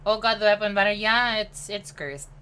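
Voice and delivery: ordinary talk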